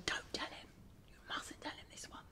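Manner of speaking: whispered aside, don't let him hear; hushed conspiratorial whisper; secret whisper. Pretend.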